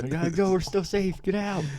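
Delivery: high, urgent action-movie voice; teasing the wife's line as an action movie, pitched high; action movie hero. Pretend. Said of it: "like an action movie".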